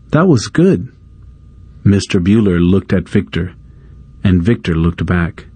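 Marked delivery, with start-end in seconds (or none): 0.1-0.9 higher, gentle praise; sweet compliment. A narrator's reading. Teresa praising Victor, gentle and high. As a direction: compliment him kindly.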